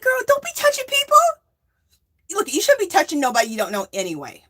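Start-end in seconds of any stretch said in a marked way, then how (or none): 0.0-1.2 much higher sassy scolding; mock scolding. Telling the stranger off, high sassy scold. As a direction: wag a finger at her with the words.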